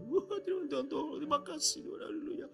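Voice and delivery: high, crying thanks to God; tearful prayer. Retold or imitated. Pastor praying for the caller, weeping while thanking God. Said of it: "tambah nangis saya".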